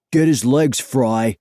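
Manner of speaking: loud, urgent bully's order; shouted order. A narrator's reading. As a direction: call out to your accomplice mid-struggle. Bully shouts order during struggle.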